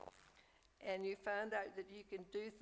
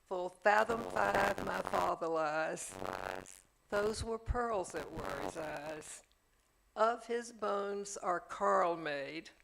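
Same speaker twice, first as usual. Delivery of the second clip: slow, loud, solemn recitation of verse; Shakespeare read aloud, slow and ringing; poem recitation; 6 dB louder than usual. Retold or imitated. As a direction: declaim it like a eulogy.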